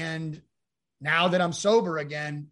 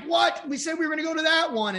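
much higher, quick confused protest; startled disbelief; 12 semitones higher, 4 dB louder than usual. Retold or imitated. Telling his ex-wife he went to the agreed DMV, high and startled. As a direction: caught off guard, defend yourself fast.

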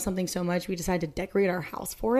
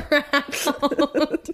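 laughing; 6 semitones higher, slower than usual. Real, not acted. Laughing while gushing.

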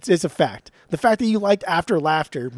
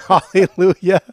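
laughing jab; 13 dB louder than usual. Real, not acted.

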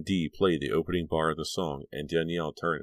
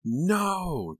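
high piercing scream of no; desperate scream; 4 dB louder than usual. Acted. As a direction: scream it out in panic. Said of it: "Danielle screamed again".

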